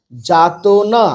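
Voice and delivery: যাতনা slow and stretched; broken into syllables. Shown as a demonstration. Teacher reading practice words for beginners, syllable by syllable. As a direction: draw out each syllable of যাতনা. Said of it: "ভেঙে ভেঙে পড়বে".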